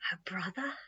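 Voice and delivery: whispered, incredulous question; hushed disbelief. A narrator's reading. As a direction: drop to an awed whisper. Tammy can hardly believe it.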